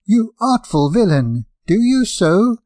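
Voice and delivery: angry retort. Acted. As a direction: snap at the guest like an offended host. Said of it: "his host replied with angry brow".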